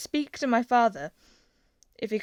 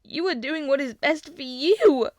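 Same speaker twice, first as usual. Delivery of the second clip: higher, louder yell; 7 semitones higher, 10 dB louder than usual. A narrator's reading. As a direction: throw his words back at him. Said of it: "Peter yelled".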